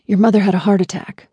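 hushed whisper. A narrator's reading. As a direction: breathe it out, voiceless. Attorney breaking bad news.

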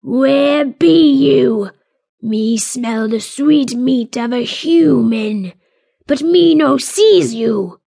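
troll hunting the invisible human; hollering troll. A narrator's reading. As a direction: bellow it out, hungry and annoyed. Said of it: "its throaty voice hollered in annoyance".

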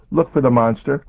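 put-on high, insistent child; little girl voice. A narrator's reading. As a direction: urge like a scared little girl. Child character voice.

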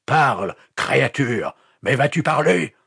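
high, forceful, insistent demands; angry interrogation. A narrator's reading. Pastor threatening the captive with fire, angry interrogation. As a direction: badger him with mounting threats.

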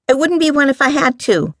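put-on high-pitched defiant girl; girlish defiance. A narrator's reading. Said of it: "Sarai said defiantly".

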